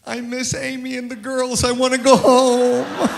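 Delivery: high, loud whimpering wail, hammed-up; homesick whine. Interpreter tells preacher he misses home, mock whining. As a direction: play the homesick friend, wailing like a child. Homesick friend finally answers.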